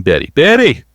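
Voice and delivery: shrill high yell for Betty; grandmother yelling. Retold or imitated. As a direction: call loudly to your daughter far back in the plane. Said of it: "she was yelling".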